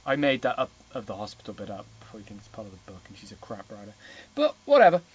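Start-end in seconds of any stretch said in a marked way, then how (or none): 0.9-3.9 low muttered aside. Real, not acted. Mocking aside, dropping voice. 4.4-5.0 high loud brush-off; loud dismissive. Real, not acted.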